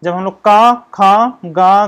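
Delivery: loud, deliberate का, खा, गा as syllables; teacher repeating का खा गा; sounding out consonants. Shown as a demonstration. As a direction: drill each sound slowly for the class. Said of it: "तो आप लोग ध्यान दीजिए".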